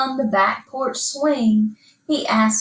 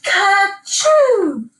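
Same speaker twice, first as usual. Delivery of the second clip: high, loud "Ka-choo" sneeze; the narrator finally sneezes; 10 semitones higher, 5 dB louder than usual. A narrator's reading. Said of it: "and sneezed".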